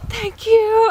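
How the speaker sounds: very high, gushing thanks; retold so happy. Traveler thanking the janitors who let her through, overjoyed. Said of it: "I was, like, so happy".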